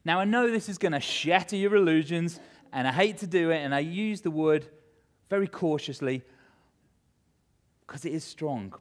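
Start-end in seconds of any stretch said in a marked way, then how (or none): none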